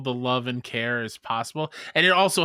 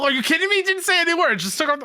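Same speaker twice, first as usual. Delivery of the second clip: high, loud, gushing excitement; can't believe how good he was; excited disbelief; 14 semitones higher, 3 dB louder, much faster than usual. Real, not acted. Co-host raving about an actor's casting, excited and loud.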